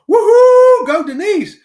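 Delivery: loud, high cheer. Real, not acted. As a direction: whoop for her like a fan. Cheering a viewer's milestone.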